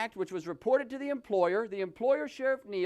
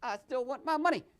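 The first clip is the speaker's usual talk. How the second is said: normal talking voice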